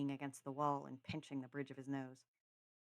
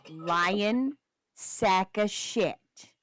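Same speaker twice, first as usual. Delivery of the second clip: very slow, drawn-out insult; emphatic mock-outraged name-calling; loud accusation; 4 semitones higher, 11 dB louder, much slower than usual. Real, not acted. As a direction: stretch each word of the insult loudly. Host accuses co-host of lying.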